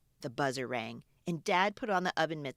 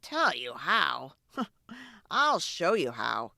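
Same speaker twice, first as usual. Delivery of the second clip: slow dad voice with a laugh; slow, chuckling dad voice; dad with a chuckle; slower than usual. Acted. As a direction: play the amused dad, taking his time. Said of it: "he said".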